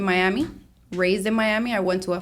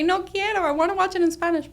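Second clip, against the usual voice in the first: put-on high childlike protest, much higher pitch; child refusing; 11 semitones higher than usual. Young daughter protesting to her mom about English-only TV, high childlike voice. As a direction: play the little kid refusing English TV.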